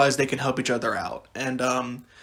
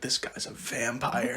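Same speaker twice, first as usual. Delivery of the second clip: awed whisper; 3 semitones lower, 12 dB quieter, slower than usual. Retold or imitated. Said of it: "I was like".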